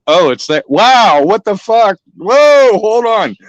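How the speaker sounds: high, rising alarmed yells at a charging moose; hammed-up fright, high and loud; panicked yelling. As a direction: voice climbing as panic hits. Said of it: "I'm like".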